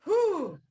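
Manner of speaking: high, loud "whew"; loud high exhale. Real, not acted. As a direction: let out a sharp, high whoop of breath. Own exclamation amid the reading.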